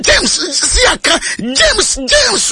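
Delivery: high calling of James, rising each time. Retold or imitated. Crowd calling to the arrested James, high calling. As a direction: call James like a crowd taunting him.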